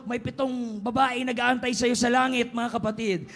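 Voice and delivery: ordinary delivery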